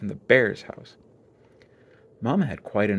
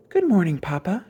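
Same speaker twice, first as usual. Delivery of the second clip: sweetly; 8 semitones higher than usual. A narrator's reading. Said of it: "as nice as you please".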